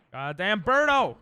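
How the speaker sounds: loud, drawn-out curse at the enemy; exasperated cursing. Real, not acted.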